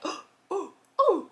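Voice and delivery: high gasping oh, jumping up in pitch; startled gasp. Retold or imitated. Spotting cops on the doorbell camera, gasping high. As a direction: catch your breath in alarm.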